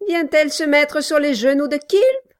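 amabilité forcée de nain; ton faussement aimable. A narrator's reading. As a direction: essaie de paraître charmant, sans y réussir. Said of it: "d'un ton qu'il croyait rendre agréable". (Quilp tente un ton agréable avec Nelly)

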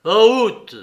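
loud, sharp order to the driver; irritated shout. A narrator's reading. Ivan ordering the driver off, irritated shout. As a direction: bark it, annoyed. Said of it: "d'un ton irrité".

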